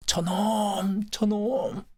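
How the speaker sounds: long, high drawn-out howl; agitated cry. Acted. Old Park cowers from the roaring express train, drawn-out cry. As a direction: stretch each word into a high-pitched wail.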